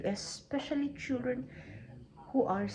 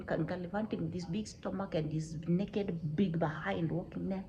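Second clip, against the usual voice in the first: usual speaking voice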